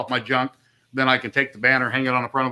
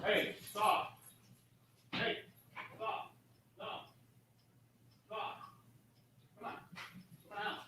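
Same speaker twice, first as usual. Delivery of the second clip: yelling at dogs off mic; 5 semitones higher, 21 dB quieter than usual. Real, not acted. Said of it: "Let me go yell at my dogs".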